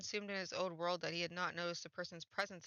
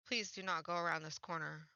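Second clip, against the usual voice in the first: normal talking voice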